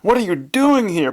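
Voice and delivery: high-pitched shout of alarm. A narrator's reading. Son confronting his dead father, high shout. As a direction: cry out high, in shocked protest. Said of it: "Pleat shouted".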